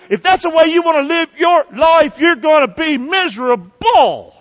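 loud high warning; preaching shout. Real, not acted. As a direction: drive the warning home. Preacher warns congregation loudly.

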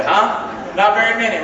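normal talking voice

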